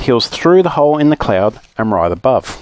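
ordinary delivery, nothing marked

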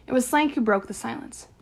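ordinary delivery

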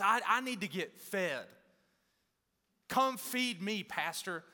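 lightly voiced whiny member wanting to be fed; a hint of a needy complainer; mock needy churchgoer. Pastor mocks church people asking to be fed, lightly whiny. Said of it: "You ever heard people talk like that?".

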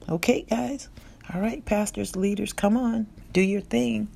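ordinary talk, nothing marked

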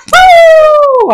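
loud, very high "woo"; high jumping whoop. Acted. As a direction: whoop as you leap a hurdle. Acted jockey leaping the hurdle.

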